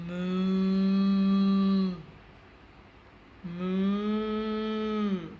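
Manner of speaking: loud mooing cow imitation. Showing a cow sound to viewers, loud moo. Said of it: "next is a cow".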